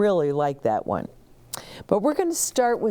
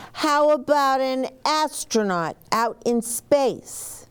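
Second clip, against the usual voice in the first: lightly voiced child; 9 semitones higher than usual.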